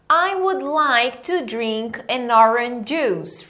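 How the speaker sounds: I would like to drink an orange juice said at half speed; teacher giving the model line before repeat; slow drill sentence. Shown as a demonstration. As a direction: pace it slowly for students to echo.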